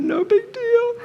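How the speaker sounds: put-on high child's voice. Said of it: "He went".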